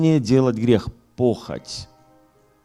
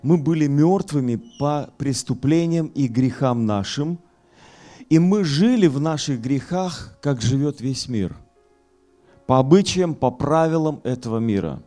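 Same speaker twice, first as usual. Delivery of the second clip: raised, forceful pulpit voice; fiery preaching; 9 semitones higher, 6 dB louder than usual. Acted. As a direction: preach it hard to the hall.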